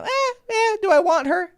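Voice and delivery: hammed-up high girly shrug; mock girly voice. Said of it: "he's just like".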